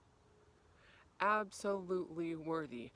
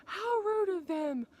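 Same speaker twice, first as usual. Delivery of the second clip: much higher, louder, slow mock-indignant "How rude of them"; offended quote; 10 dB louder, slower than usual. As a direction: play your old offended self. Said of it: "I'd be like".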